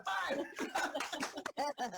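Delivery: high laughing count. Real, not acted. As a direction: call it out mid-laugh. Laughing greeting latecomer.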